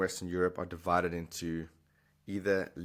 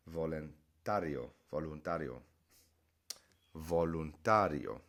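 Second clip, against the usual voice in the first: voluntario slow, stress on the A: volun-TA-rio; 2 semitones lower than usual. Shown as a demonstration. Teacher showing stress in voluntario to students. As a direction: lean on the A in voluntario. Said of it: "stress is on that A".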